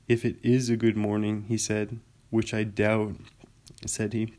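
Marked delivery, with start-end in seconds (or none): 0.1-1.4 mildly gloomy, doubting donkey; a touch of glum scepticism. A narrator's reading. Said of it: "said Eeyore gloomily". 2.3-3.2 understated glum doubt; a touch of donkey gloom; gloomy. A narrator's reading. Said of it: "said Eeyore gloomily".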